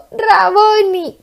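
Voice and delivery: high, slow cry of recognition; tearful recognition. Acted. Mary recognising her teacher at the tomb, crying out. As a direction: cry it out as you recognise him.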